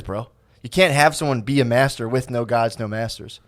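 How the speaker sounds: normal talking voice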